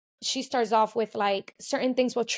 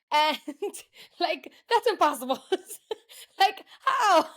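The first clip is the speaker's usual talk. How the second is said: real laughter breaking through, rising much higher; laughing; 9 semitones higher than usual. Real, not acted. Guest laughing at reading a child's mind, incredulous. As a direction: laugh your way through the disbelief.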